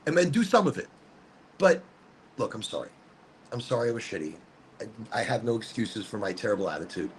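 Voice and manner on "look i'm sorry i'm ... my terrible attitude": low, soft contrite voice; quiet, low-pitched admission of fault; subdued apology. Real, not acted. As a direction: drop your voice and apologize. Apologizing for bad behavior on camera.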